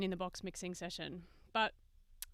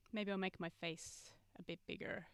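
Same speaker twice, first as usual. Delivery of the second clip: ordinary talk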